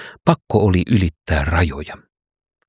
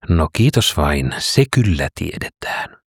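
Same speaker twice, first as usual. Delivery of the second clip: usual speaking voice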